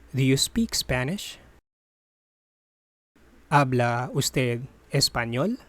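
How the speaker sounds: ordinary talk, nothing marked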